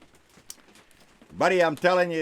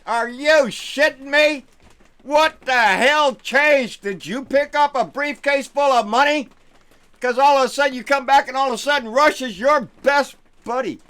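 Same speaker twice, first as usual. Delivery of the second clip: much higher and louder, incredulous fury; outraged yelling; 11 semitones higher, 8 dB louder than usual. Real, not acted. Vlogger to viewers about Rand Paul's Russia trip, outraged yelling.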